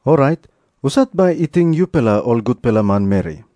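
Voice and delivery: ordinary delivery, nothing marked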